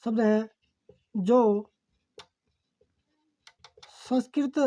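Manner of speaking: measured note-taking dictation, phrases repeated. Real, not acted. Teacher dictating a definition for students to write, dictation. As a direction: dictate word by word with long pauses.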